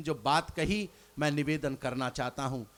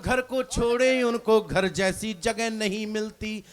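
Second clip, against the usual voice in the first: measured recited couplet, each line dwelt on; poem recitation; 5 semitones higher, 7 dB louder than usual. Acted. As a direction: recite it like a stage poet. Poet repeats couplet.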